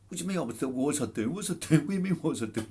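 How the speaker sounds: high, indignant Cockney voice giving it back; cocky Cockney comeback. Retold or imitated. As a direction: fire it back like a cheeky Cockney lad. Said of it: "like I said, a Cockney lad".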